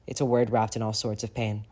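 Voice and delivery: plain reading voice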